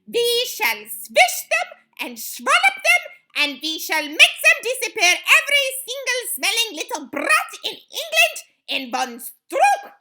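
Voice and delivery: high, loud witch tirade; thundered. A narrator's reading. Grand High Witch vowing to destroy children, thundered. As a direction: thunder out the threat. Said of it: "thundered the Grand High Witch".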